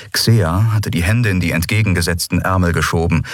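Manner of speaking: usual speaking voice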